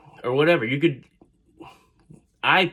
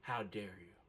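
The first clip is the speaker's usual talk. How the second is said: near-silent, much softer how dare you; hushed fury; 4 semitones lower, 23 dB quieter than usual. Retold or imitated. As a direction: breathe the words out in disbelief. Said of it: "raging on the inside".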